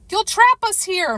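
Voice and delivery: high urgent yell. Acted. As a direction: yell it out in alarm. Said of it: "Mary yells".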